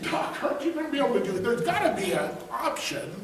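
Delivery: high, hurried retold plea; pleading. Patient begging the doctor for an option, mock pleading.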